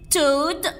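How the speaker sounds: normal talking voice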